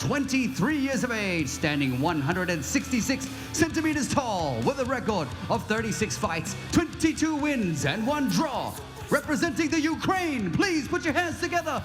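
high, very slow, stretched-out announcer calls; ring announcer. Acted. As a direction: drag out every word for the crowd. English ring introduction, drawn out.

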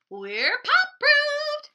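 high, slow, loud shout; shouting porcupine. Acted. Isabel bursts into the classroom, loud proud shout. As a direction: belt it out proudly. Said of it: "shouted Isabel".

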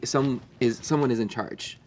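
normal talking voice

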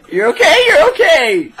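high squeal through laughter; laughing reassurance. Real, not acted. Friend laughing at partner who fell in water, squealing. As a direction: reassure while cracking up.

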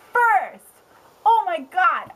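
can barely contain it; excited. Real, not acted. Showing the viewer her new books, bursting with excitement. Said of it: "excited rant".

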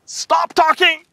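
high, loud shout. Pretend. Moses losing patience with the people, shouting. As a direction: snap it at the crowd. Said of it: "You know what I was about to say".

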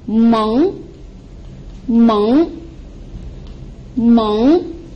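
meng drawn out with an upward sweep; rising tone. Shown as a demonstration. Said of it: "and you combine it with m".

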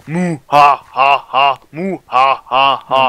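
mock villain laugh; evil laugh. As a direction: cackle like a cartoon villain.

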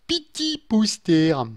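high-pitched, drawn-out little words; playful high sing-out; playful cutesy voice. Real, not acted. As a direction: stretch it out high and cute. Playfully names what to put up.

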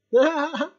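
high delighted laugh; burst of laughter. Acted. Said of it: "en éclatant de rire".

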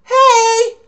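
high, loud photo call. Acted. Friends posing for a souvenir photo, calling out.

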